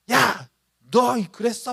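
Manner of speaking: acted angry outburst. Preacher acts someone blowing up at an unfair person, angrily. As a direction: act out flaring up at someone. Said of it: "막 화를 내고".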